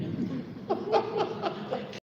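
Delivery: high, breathy burst of real laughter. Real, not acted. Speaker laughing at her daughter's clever comeback, a high, breathy burst. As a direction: laugh out at the punchline.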